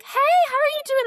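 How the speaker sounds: high, loud, fast sing-song hello; fake sweet greeting. Instructor cooing in the boy's face, high fast fake-sweet. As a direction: coo at the child like a pushy stranger. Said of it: "gets in his face and is like".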